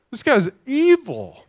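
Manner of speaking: high, slow indignant exclamation; mad. Retold or imitated. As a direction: re-enact outrage, stretching it out high. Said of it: "and I'm getting mad".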